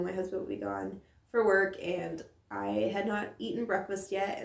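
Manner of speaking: ordinary talk, nothing marked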